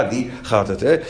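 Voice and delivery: usual speaking voice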